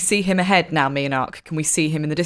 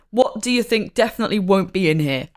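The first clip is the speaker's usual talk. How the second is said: ordinary talk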